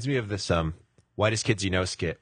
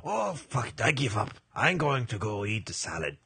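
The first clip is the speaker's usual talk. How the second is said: mock-French accent, resigned; French-accented tiger throwing in the towel; giving-up tiger. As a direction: give up in a comic French accent. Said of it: "He gives up".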